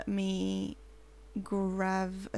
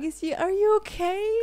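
lightly voiced high, worried question; pitched-up concerned check, rising at the end; mock concern; 8 semitones higher than usual. Gamer voicing sims checking on each other, high mock concern.

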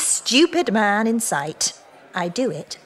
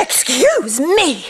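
indignant; 4 semitones higher, slower than usual. Acted. Rebuffing his advance.

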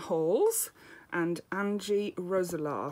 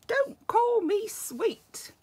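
much higher, slow and stretched; high protesting refrain; 12 semitones higher, slower than usual. Acted. Little monster answering the mummies and daddies, high and slow. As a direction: float it up high and drag it out. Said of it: "and he's saying".

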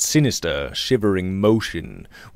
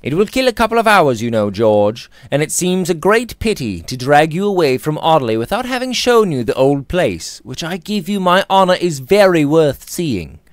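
lightly voiced, breezy and persuasive; casual young gentleman; 6 semitones higher, 4 dB louder than usual. A narrator's reading. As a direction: coax a friend along, quickly at first. Robert persuading George.

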